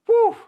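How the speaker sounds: high loud whistle of disbelief; whew. Real, not acted. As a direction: blow out a stunned whew. Reacting to Job's harsh words.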